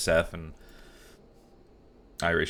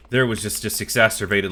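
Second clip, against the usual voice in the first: loud, higher excited rant; 3 semitones higher, 8 dB louder than usual. Real, not acted. Telling chat about the movie, loudly worked up. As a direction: blurt it out all worked up.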